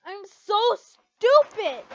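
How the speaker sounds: slow, loud, high wail at herself; frustrated outburst. Acted. Lucy berating herself after the fake confession, loud wail. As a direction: cry out in self-blame.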